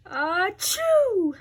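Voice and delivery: sneeze. Acted. Gerald sneezes.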